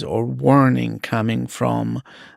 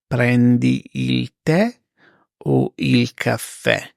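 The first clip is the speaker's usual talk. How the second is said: slow, spaced repeat for learners; slow teacher repeat; slow and clear. Shown as a demonstration. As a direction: say it slowly, word by word. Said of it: "slow repeat for learners".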